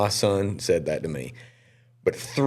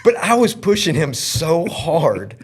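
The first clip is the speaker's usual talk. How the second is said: earnest confession; 7 semitones higher, 4 dB louder than usual. Real, not acted. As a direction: press the point from the heart. Emotional confession about son.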